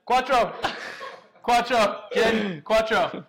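loud, laughing Italian call-out; shouting. Acted. Playful call-out of the number.

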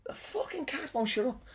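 whispered grumble under the breath; real irritated whispered aside; annoyed whisper aside. Real, not acted. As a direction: complain quietly so it stays off-mic. Muttered aside about noisy cat.

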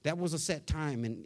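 usual speaking voice

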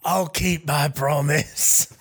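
drawn-out tearjerker pledge; mock grief. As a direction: promise it to a dying friend, hammed up. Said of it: "It's a real tearjerker".

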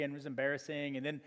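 ordinary talk, nothing marked

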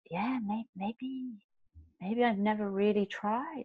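very soft, hesitant musing; thinking to oneself. Retold or imitated. Cyclist doubting past effort, quiet hesitant thought. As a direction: muse quietly, trailing between pauses. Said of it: "I remember cycling home thinking".

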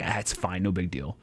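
quiet shrug. Retold or imitated. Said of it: "So I was like".

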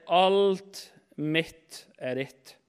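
very slow, a pause after each part; slow, weighty correction; emphatic true version. Retold or imitated. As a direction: stretch out "Alt", then set down "mitt" and "er ditt" separately. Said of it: "Men han sier".

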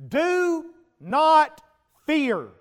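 God's command. Acted. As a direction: command them not to fear, with full authority. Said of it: "God steps up and He says".

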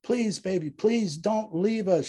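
wife pleading desperately with husband on the phone; desperate screamed plea. A narrator's reading. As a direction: beg him not to go. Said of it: "she screams".

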